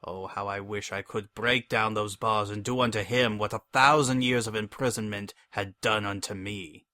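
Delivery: ordinary delivery, nothing marked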